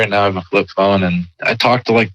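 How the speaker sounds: ordinary talk, nothing marked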